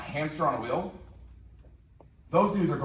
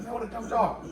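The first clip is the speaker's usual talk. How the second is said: put-on kid scoffing; 8 semitones higher than usual. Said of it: "I was like".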